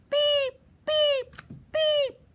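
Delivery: high, loud horn beeps; little truck's alarm. Acted. Repeating the stuck truck's call, high beeps. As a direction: beep high for rescue. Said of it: "He said".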